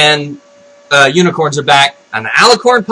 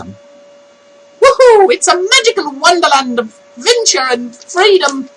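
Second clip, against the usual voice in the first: high, whooping cartoon Irish; mock Irish voice; 10 semitones higher than usual. Vlogger finally landing the catchphrase, exuberant mock Irish. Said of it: "I can't do the Irish voice".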